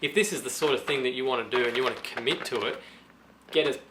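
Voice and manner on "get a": ordinary talk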